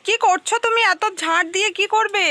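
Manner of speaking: high, loud call to someone far off; yelling across the room. Real, not acted. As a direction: shout over to them. Calling to someone across the roof.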